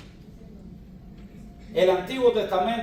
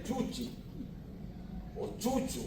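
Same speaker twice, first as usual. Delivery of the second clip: a light mock voice, pitched higher and softer; mocking nickname; 8 semitones higher, 10 dB quieter than usual. Preacher mimicking how people nickname Jesus, lightly mocking. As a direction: toss off the nickname teasingly. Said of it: "a los Jesús le dicen".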